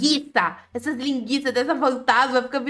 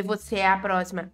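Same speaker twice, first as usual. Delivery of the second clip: 1 mock menacing threat; 6 semitones lower, 4 dB quieter than usual.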